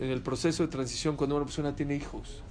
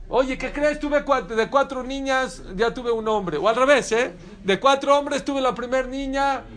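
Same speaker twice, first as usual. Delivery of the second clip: high, loud mimicry of a thrilled parent; excited announcement, imitated; 7 semitones higher, 6 dB louder than usual. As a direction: burst out with big news, pitched way up. Acts parent announcing baby's birth. (anuncio emocionado, imitando a un padre)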